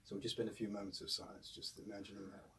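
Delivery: soft, fading meditation voice; guided meditation. Acted. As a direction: guide them gently into silence. Leads a meditation.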